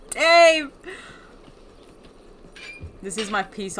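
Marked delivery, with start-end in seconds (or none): 0.1-0.7 loud, raised wail of a name; theatrical grieving shout; mock-grief cry. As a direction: cry out for a lost friend, hammed-up. Calls out to dead Dave.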